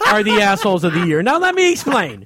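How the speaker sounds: ordinary delivery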